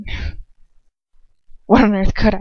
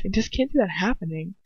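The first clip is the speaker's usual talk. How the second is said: usual speaking voice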